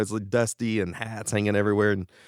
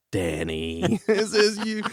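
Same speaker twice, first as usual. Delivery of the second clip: mock skeptical; 11 semitones higher, slower than usual. Playing along with supposed prank call.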